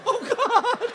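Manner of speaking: high, loud exasperated "oh God"; hammed-up exasperation, high and loud. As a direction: groan in comic despair. Exasperated groan at nurse.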